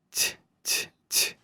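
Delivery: "т" breathed out very softly, with no voice; barely a whisper on "т"; soft hiss of a palatal "т". Shown as a demonstration. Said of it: "whisper this I".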